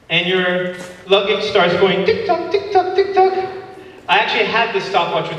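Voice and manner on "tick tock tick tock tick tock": ticking clock. Retold or imitated. Imitating luggage clock ticking.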